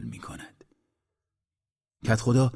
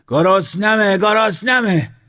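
loud, very high hungry yell; shouting; 12 semitones higher, 8 dB louder, slower than usual. A narrator's reading. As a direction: cry out twice, pushing higher. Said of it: "موسرخه داد زد". (داد زدن از گرسنگی)

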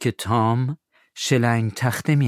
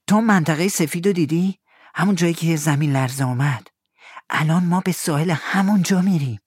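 put-on higher eager boy; Tom's boyish voice; 8 semitones higher than usual. Tom asks Huck to help find the money, eager. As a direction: sound young and keen.

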